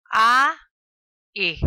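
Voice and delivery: A and E called out very loud, one at a time; loud vowel calls. Shown as a demonstration. Teacher calling vowels to the class, loud and separated. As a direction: bark each vowel clearly to the class. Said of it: "La letra U es una vocal en guaraní".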